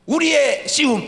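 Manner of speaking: high, slow proclaiming start of the verse; pulpit proclamation. Acted.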